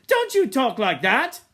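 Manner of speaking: high, loud scolding; sharp rebuke. A narrator's reading. Ralph rebukes despairing Eric, loud and stern. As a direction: scold him hard.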